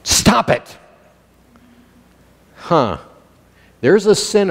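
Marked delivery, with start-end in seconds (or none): 0.0-0.8 firm, isolated "Stop it"; emphatic command. Acted. Jesus to worriers, emphatic "Stop it". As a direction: land "Stop it" as one firm order. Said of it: "he said emphatically".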